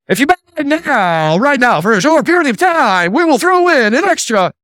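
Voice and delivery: high, pushy infomercial pitch. Retold or imitated. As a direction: sell it like a TV pitchman. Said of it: "the infomercial guy".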